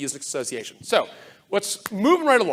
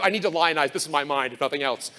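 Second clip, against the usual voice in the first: usual speaking voice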